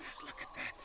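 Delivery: breathy hushed whisper; awed whisper. Real, not acted. Keeper whispers in awe beside the huffing python. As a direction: whisper it so the snake stays calm.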